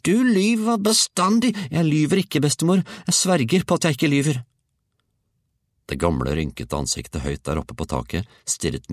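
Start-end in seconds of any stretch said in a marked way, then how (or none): 0.0-1.5 very high, drawn-out loud screech; grandmother's howl. A narrator's reading. Grandmother accuses grandson of always lying, high howl. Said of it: "hylte bestemor". 1.7-4.4 put-on high, loud, insistent child; protesting boy. A narrator's reading. Georg swears to grandmother he is truthful, high protest.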